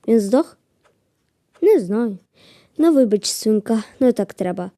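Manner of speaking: normal talking voice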